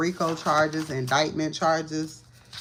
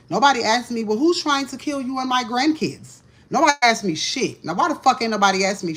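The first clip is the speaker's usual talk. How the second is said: louder, higher angry complaint; indignant; 6 semitones higher, 5 dB louder than usual. Real, not acted. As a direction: demand why nobody asked. Indignant rant rising.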